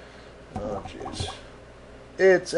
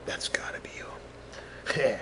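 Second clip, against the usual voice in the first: slow, breathy whisper; hushed movie quote; 14 dB quieter, slower than usual. Acted. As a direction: whisper it slowly, playing a film line. Acting out a movie line.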